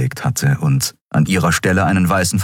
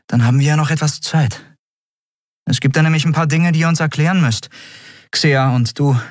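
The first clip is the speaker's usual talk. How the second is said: a higher, firm woman's voice demanding answers; lightly voiced Endriel; 10 semitones higher, faster than usual. A narrator's reading. Endriel demands answers from Kero and Xea, lightly voiced. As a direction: press them for the truth, lightly voiced.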